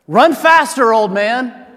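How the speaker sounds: high, loud heckle from a passing car; mock shout at a runner; retold yell. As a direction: imitate someone yelling out a car window. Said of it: "they yelled out the window".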